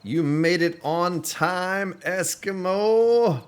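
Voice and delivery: high, loud, slow drawn-out greeting; hearty welcome. Real, not acted. Streamer greeting a viewer who just arrived, loud and drawn out. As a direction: boom out a big welcome to the viewer.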